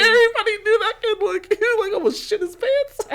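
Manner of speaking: high, fast laughing talk. Real, not acted. Telling co-host about the nervous kid, laughing high. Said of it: "laughing through story".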